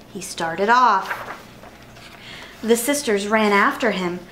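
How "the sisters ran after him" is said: usual speaking voice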